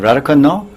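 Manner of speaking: "Radhakund, no?" pitched high, rising on "no"; Indian questioning intonation. Retold or imitated. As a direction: ask "Radhakund, no?" with an Indian-style rising tag. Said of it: "intonation indicates that it's a question".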